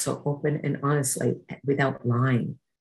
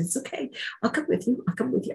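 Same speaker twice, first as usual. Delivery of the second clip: reassuring; 9 semitones higher, 5 dB quieter, faster than usual. Retold or imitated. Calming the frightened girl.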